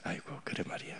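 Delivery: soft pitying whisper; whispered sigh. Retold or imitated. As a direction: breathe it out with mock pity. Pitying aside to atheists. (안타까워하며 속삭이는 한숨)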